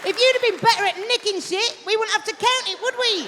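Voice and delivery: an outraged colleague berating Kerry over the count; angry shout. Acted. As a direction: tear into her for making everyone count. Nurse yelling at Kerry over count.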